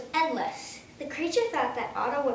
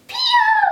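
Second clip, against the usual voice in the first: very high, very loud piercing cry; ear-splitting creature scream; piercing creature shriek; 13 dB louder than usual. A narrator's reading. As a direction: shriek it to scare off an eagle. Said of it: "there was a piercing".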